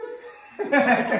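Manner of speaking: loud real laugh; cracking up; burst of laughter. Real, not acted. Laughing at the recording.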